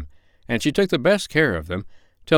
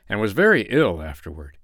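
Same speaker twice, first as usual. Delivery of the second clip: usual speaking voice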